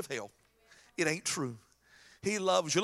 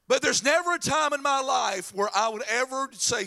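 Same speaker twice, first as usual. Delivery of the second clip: usual speaking voice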